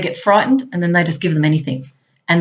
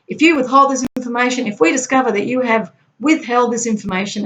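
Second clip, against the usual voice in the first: raised pitch, forceful formal demand; strongly worded threat; 5 semitones higher than usual. Retold or imitated. Agent delivering an ordered threat to the Iraqis, forceful. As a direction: press it home as an intimidating ultimatum. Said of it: "being instructed to strongly word this".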